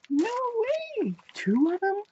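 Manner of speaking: high, loud delighted disbelief; real thrilled surprise at two gifts. Real, not acted. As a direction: squeal it as if you can't believe your luck. Surprise at a second gift.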